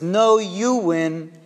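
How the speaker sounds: retold delighted "you win"; jubilant reversal. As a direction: turn it back on them, stretching "No, you win" slowly and emphatically. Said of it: "jumps up and he starts clapping".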